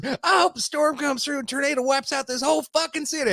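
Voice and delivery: high-pitched mock-angry rant; hammed-up woman ranting way up high; imitated furious curse. Homeless woman cursing the whole city, high mock-angry rant.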